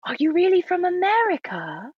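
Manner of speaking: high-pitched imitation of a British child; British child accent. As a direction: put on a wide-eyed little British kid's voice. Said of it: "not gonna do a British accent".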